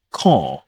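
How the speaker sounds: "car" as "cah", long vowel, no r; dropped final R. Shown as a demonstration. Teacher repeats the word for learners, R dropped. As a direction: say "car" British style with the R silent. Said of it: "Dropping the letter R at the end".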